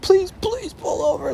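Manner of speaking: high, breaking, tearful begging; crying plea. Retold or imitated. Friend begging the driver to pull over, high tearful plea. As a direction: beg through tears to stop the van. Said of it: "like, crying".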